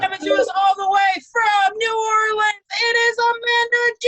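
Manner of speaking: loud, fast emcee introduction; announcer hype. Real, not acted. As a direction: hype up the next act. Hosting, introducing next comedian.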